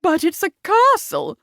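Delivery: high, plaintive mother; fluttery high-pitched objection; protesting. A narrator's reading. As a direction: protest in dismay. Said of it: "protested her mother".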